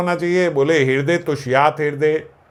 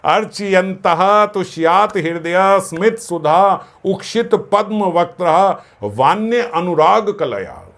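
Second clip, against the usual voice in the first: verse recitation; 5 semitones higher, 4 dB louder, slower than usual. Acted.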